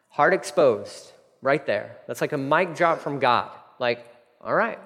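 ordinary talk, nothing marked

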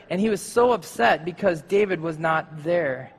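ordinary delivery

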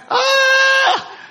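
loud high laughing cry; laughing shout. Real, not acted. Laughing outburst after quote.

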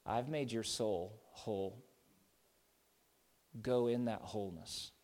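low, hushed and gentle; soft assurance. Acted. Jesus softly blesses the healed woman, hushed and tender. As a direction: speak peace over her quietly. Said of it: "But now Jesus says".

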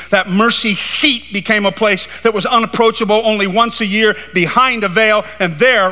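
high, driving preacher's delivery; impassioned preaching. Real, not acted. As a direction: preach it with rising force, pitched high. Preaching at full force.

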